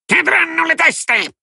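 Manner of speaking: very high, slow, sing-song imp cry; an imp gloating over heads to come; gleeful goblin cry. A narrator's reading. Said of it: "Folletto saltellava e ghignava". (canto acuto e lento da folletto)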